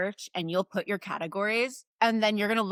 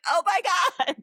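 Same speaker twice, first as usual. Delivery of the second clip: excited; 10 semitones higher, 3 dB louder, faster than usual. Retold or imitated. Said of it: "And I'm like".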